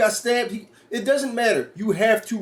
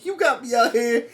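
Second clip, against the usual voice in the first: laughing mock threat; 4 semitones higher than usual. [name] threatening townspeople.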